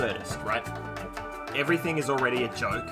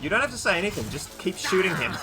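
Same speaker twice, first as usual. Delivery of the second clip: excited; 8 semitones higher, 4 dB louder than usual. Real, not acted. Urging character loudly.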